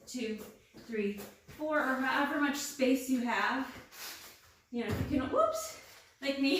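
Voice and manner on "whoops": sudden high yelp of surprise, half laughing; startled whoops. Real, not acted. Trainer loses her balance mid-crawl, startled high whoops. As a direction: caught off balance, squeak it out.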